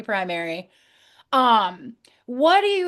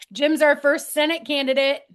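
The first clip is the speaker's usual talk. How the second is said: ordinary talk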